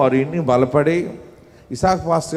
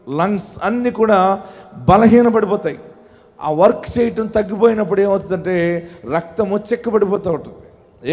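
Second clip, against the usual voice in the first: steady sermon voice, slightly raised; earnest preaching; 7 semitones higher than usual. Acted. Pastor preaching to the congregation on health, earnest sermon.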